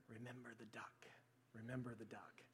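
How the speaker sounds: low, very soft whispered reminder; menacing whisper. Retold or imitated. Sister whispering in brother's ear when Grandma was gone, whispered reminder. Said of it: "she would just whisper in his ear".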